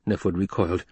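ordinary delivery, nothing marked

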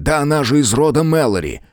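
indignant family pride; indignant outburst. A narrator's reading. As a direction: protest hotly, voice lifted. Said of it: "с грохотом опустил кулак на конторку".

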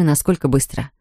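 plain reading voice

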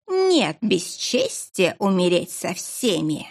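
slow, eerie dark presence; drawn-out menacing voice in the head; telepathic voice. Acted. The darkness speaks again.

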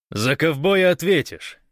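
high, loud playful threat; cheerful shout. A narrator's reading. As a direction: shout it back merrily. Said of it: "крикнул гость весело".

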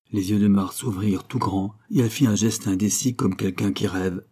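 usual speaking voice

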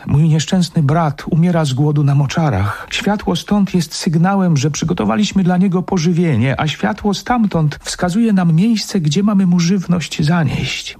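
high, convincing female confession; earnest woman's voice. Acted. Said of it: "Słowa kobiety brzmiały poważnie i przekonująco".

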